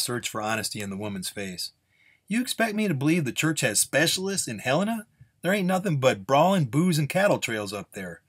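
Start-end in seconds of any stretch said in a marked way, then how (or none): 2.3-8.2 high-pitched, disbelieving challenge; incredulous gunslinger. Acted. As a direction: scoff at her unlikely story. Gunslinger doubts the story.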